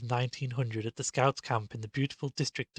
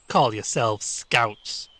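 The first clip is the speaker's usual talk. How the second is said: mocking jeer; 7 dB louder, much slower than usual. A narrator's reading. Stanley mocking friends.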